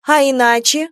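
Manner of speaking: desperately brave retort; desperate defiance. Acted. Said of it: "с отчаянной смелостью". (с отчаянной смелостью)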